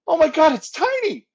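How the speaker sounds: high, loud gleeful exclamation, imitating a friend; mock-ecstatic shout of recognition; delighted greeting. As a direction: burst out high and loud, retelling his delight. Retelling James's delighted greeting.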